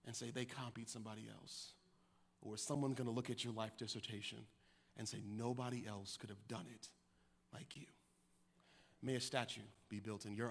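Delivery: low, hushed benediction; solemn sermon close. Real, not acted. Preacher leaving the congregation a charge on legacy, hushed and solemn. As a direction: let it settle into a very soft, low charge.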